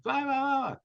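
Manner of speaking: high, wordless mock yell. Tow truck driver yelling from his cab, mocked. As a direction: imitate distant yelling without real volume. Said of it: "And he's, like, yelling".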